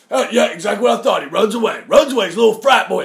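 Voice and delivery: loud, high-pitched ranting heckler; Alex Jones impersonation. Retold or imitated. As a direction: jeer loudly in a worked-up mocking impression. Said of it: "That was a great Alex Jones impersonation".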